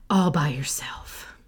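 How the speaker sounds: hushed, conspiratorial whisper; whispered aside. Real, not acted. Host to listeners, whispering the secret pleasure. Urging a solo errand.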